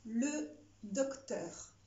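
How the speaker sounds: dictating. Shown as a demonstration. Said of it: "C'est parti".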